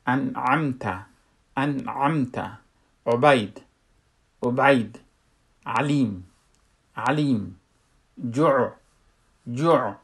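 "انعمت", "عبید", "علیم", "جوع" with ain voiced softly from mid-throat. Shown as a demonstration. Teacher giving examples, soft mid-throat ain in "انعمت", "عبید". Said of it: "اما نرم".

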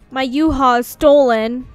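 ranting gamer sums up her losses; loud complaint. Real, not acted. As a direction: belt out the grievance.

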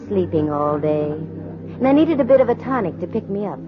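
dry, knowing private-eye narration; noir narration. A narrator's reading. Candy narrates her case to the audience, dry noir. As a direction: tell it wryly to the listener.

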